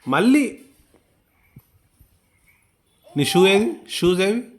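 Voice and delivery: high, loud exasperated questioning; scolding. Real, not acted. Parent asking a child where the shoes went, scolding.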